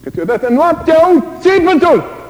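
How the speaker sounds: high, loud anguished exclamation; loud cry. Real, not acted. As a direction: call out from memory. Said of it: "preacher recalls screams". (strigăt înalt)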